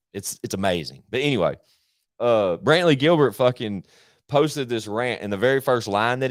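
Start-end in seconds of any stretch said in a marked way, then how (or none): none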